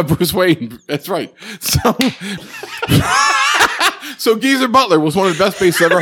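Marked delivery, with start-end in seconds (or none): 2.0-4.1 amused laughter. Real, not acted. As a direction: laugh at your own slip. Laughing at own mistake.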